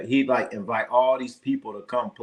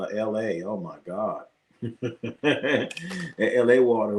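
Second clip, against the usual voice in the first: ordinary delivery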